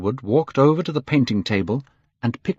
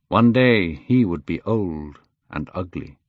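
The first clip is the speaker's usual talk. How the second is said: usual speaking voice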